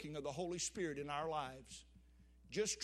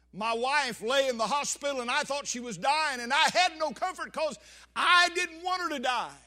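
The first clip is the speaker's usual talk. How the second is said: raised, higher pleading voice; impassioned preaching; 6 semitones higher, 10 dB louder than usual. Real, not acted. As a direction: pour out the grief, full voice. Preacher recalls wife near death.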